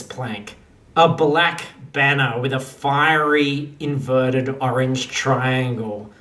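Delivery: ordinary delivery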